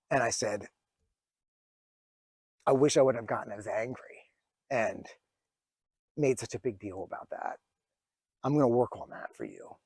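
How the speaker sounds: a father apologising to his daughter, voice thick with emotion; choked up. Real, not acted. A father apologising to his little daughter, choked up. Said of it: "it's making me emotional".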